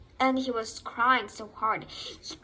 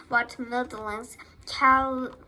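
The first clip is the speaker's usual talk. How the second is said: plain reading voice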